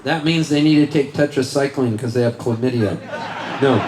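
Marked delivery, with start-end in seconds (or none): none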